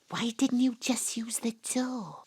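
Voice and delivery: soft unvoiced whisper; hushed complaint. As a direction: hiss it so nobody hears. Whispering to spirit unseen.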